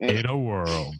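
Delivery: hint of a trailer narrator; playful mock announcer; movie trailer announcer. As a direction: lightly mimic a movie trailer voice-over. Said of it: "the movie trailers or something".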